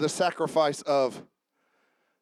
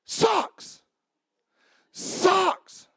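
incredulous exclamation; 10 semitones higher than usual. Acted. Exclaiming about socks arguments.

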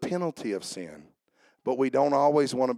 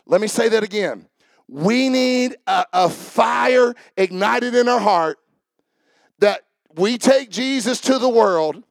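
preaching at full force; 6 semitones higher, 7 dB louder than usual. Acted. Pastor rallying the congregation, preaching at full force. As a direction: preach it like a revival fire.

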